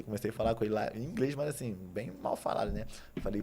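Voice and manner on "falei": ordinary delivery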